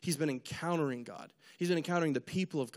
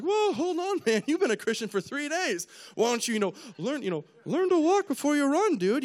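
high, loud "whoa, hold on, man" like a laid-back dude; scolding bro voice; 10 semitones higher, 4 dB louder than usual. Acted. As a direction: talk him down, high and loud, like a surfer scolding a friend.

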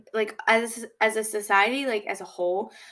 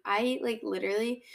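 ordinary talk, nothing marked

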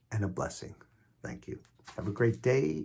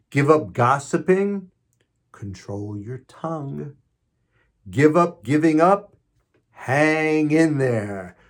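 Lenten list reading; 4 semitones higher, 8 dB louder, slower than usual. Acted. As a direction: recite each pair deliberately, like a litany.

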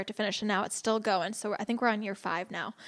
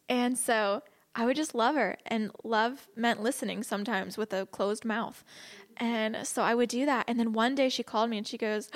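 usual speaking voice

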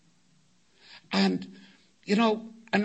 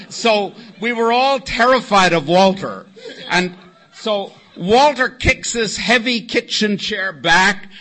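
normal talking voice

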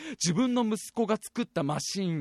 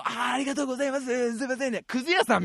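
rakugo junk dealer; 4 semitones higher than usual. Retold or imitated. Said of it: "落語で言うとこのね".

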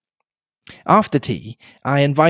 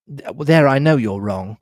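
ordinary delivery

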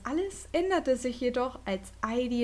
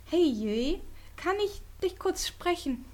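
higher, hopeful schoolgirl voice; Heidi, girl's voice; 8 semitones higher than usual. A narrator's reading. Heidi asks Yui after school, lightly higher girl voice. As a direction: voice Heidi as a shy classmate asking. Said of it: "fragte Heidi".

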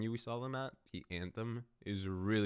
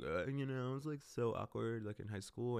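mock cutesy; 2 semitones higher, 4 dB quieter than usual. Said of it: "try to be cute about it".

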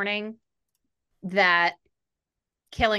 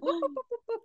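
much higher, softer quick whoops; mock hurry-along; 5 dB quieter, much faster than usual. Reader mocking the author skipping a plot hole, much higher. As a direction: whoop past it like hiding a mistake.

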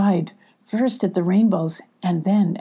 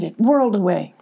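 ordinary delivery

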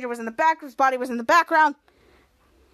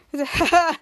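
usual speaking voice

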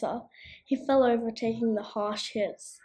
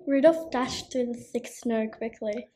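usual speaking voice

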